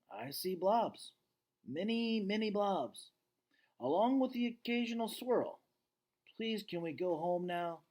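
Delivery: a lightly voiced exasperated dad; weary dad. George complains he wants to go home, weary.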